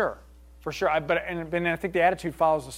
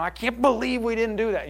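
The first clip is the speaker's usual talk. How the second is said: mock exasperated outburst, pitched up; negative passion; 7 semitones higher, 3 dB louder than usual. Shown as a demonstration. Leader showing passion tipped into anger, negative passion. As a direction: demonstrate angry disbelief at the team, lightly acted. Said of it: "positive versus negative that comes with it".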